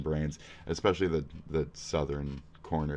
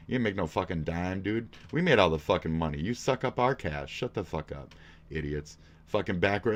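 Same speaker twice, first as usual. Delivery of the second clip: usual speaking voice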